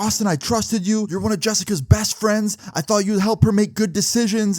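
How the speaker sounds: high, indignant mock yelling; retold screaming mom. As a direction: scold him like a let-down, furious mother. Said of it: "she's screaming at me".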